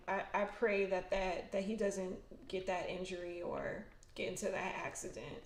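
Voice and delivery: ordinary talk, nothing marked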